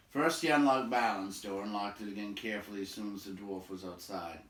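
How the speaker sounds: ordinary delivery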